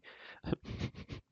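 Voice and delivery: hushed, voiceless giggle; whispered laugh. Real, not acted. Player telling viewers of cat pee, whispered giggle. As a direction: giggle while keeping to a whisper.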